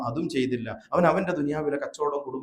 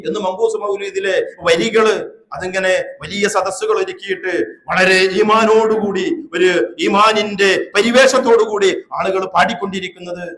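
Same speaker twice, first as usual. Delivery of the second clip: loud, raised sermon voice; forceful preaching; 6 semitones higher, 9 dB louder than usual. Real, not acted. Preacher criticises the mawlid verses to the gathering, forceful preaching.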